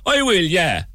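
high Cork lilt answering cheerfully; Cork Alexa reply. Pretend. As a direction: play the smart speaker in a Cork accent. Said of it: "Cork Alexa".

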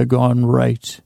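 ordinary talk, nothing marked

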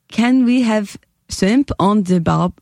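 slow, halting mock South Korean accent; tentative South Korean impression. Shown as a demonstration. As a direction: attempt Korean-accented English, word by word. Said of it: "a South Korean".

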